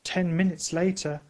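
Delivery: ordinary delivery, nothing marked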